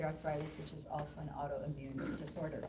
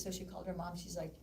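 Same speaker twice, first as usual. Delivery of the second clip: ordinary talk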